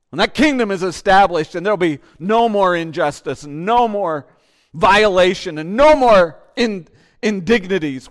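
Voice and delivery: loud, rising preacher's call; preaching at full force. Acted. Pastor proclaiming to women the end of injustice, loud preaching. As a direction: proclaim it to the whole room.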